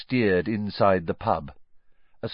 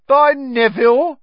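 high, loud cheery farewell; calling out; 11 dB louder than usual. Acted. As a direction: call it across the room as you leave. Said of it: "called Stan".

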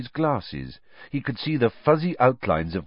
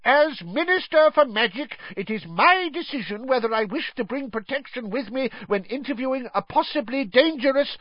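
pompous bluster. Acted. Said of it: "roared Fudge".

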